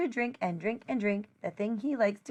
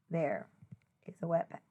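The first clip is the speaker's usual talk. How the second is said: hushed whisper for the wet pet; soft storybook whisper; 7 semitones lower, 9 dB quieter than usual. Acted. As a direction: breathe it like a secret.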